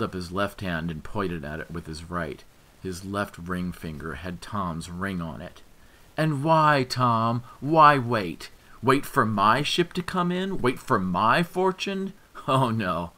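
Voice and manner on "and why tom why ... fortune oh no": loud, high, taunting shout; mocking yell. A narrator's reading. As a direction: throw it at him, gloating.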